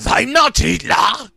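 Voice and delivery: put-on slow, high, screamed in German accent. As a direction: yell the denial like a dictator. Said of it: "the Hitler-sounding man screamed".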